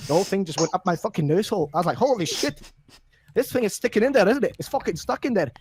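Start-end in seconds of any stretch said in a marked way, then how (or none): 2.0-5.5 high-pitched startled exclamation; hammed-up shock; acted alarm. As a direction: raise the pitch in mock panic. Narrator acts out a startled reaction.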